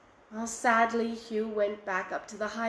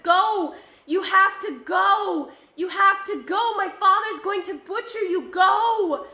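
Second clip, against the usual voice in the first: high, loud frantic shouting; 7 semitones higher, 6 dB louder than usual. Acted. Boy shouting at the silver cow to flee, frantic and loud. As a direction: yell at the cow to run for its life.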